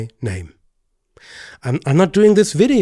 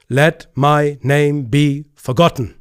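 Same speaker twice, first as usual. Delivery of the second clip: slow, louder solemn declaration; solemn quote; 2 semitones higher, 5 dB louder, slower than usual. Retold or imitated. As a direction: proclaim it slowly like a vow. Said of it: "And he said".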